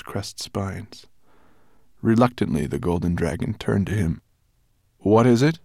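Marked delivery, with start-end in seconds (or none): 5.0-5.6 louder, higher irritable grunt; grunted question. A narrator's reading. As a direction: grunt it back, annoyed at being disturbed. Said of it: "he grunted".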